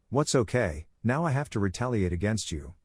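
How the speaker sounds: ordinary delivery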